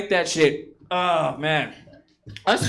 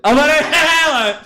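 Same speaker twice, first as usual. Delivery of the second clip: laughing declaration; 4 semitones higher, 5 dB louder, much slower than usual. Acted. Laughing, slow, loud.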